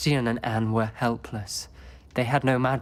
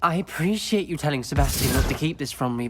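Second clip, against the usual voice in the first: ordinary talk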